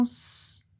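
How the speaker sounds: long sustained hiss for "S"; pure held sss on "S"; isolated S sound. Shown as a demonstration. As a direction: say "S" as a drawn-out sss, not the letter name. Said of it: "tu entends le son S".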